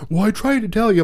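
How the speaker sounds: mock the son's feeble excuse; whiny teen excuse. Mother imitating her son's whiny excuse, high and mocking.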